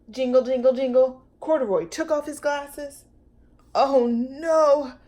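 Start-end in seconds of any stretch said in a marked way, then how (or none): 3.7-4.9 high alarmed gasp, louder; startled worry. Acted. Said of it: "thought Corduroy".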